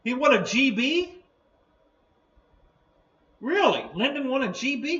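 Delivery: high, loud surprised questions. Real, not acted. Reading surprising chat news.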